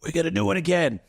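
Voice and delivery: rally the troops; rallying call. Retold or imitated. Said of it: "and go".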